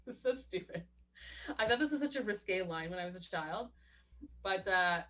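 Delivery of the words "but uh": normal talking voice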